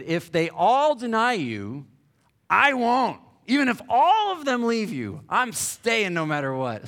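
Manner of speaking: loud, high-pitched bragging vow; Peter's brash boast. Retold or imitated. Peter boasting to Jesus he'll never leave, loud and high. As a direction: shout the promise like a cocky disciple. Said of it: "Peter opened his big mouth again and said".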